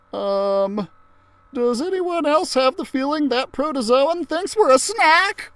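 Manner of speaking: put-on uneasy boy sure he's about to be eaten; Arnold, worried. Arnold asking the class about the protozoan, high and nervous.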